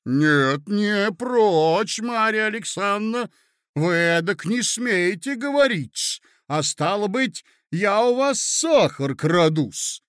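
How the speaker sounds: indignant lady. Acted. Lady confronting her hostess over the sugar story, indignant. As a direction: take offence and stretch the key words.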